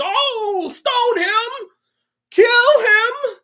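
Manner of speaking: high, loud falsetto cry; mock bloodthirsty mob. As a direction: shriek for punishment like a hypocrite. Mocks a judgmental mob.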